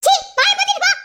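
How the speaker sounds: very high, loud disgusted yell; angry shout. Acted. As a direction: spit it out and shut him up. Sister shouting at brother.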